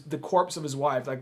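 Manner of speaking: normal talking voice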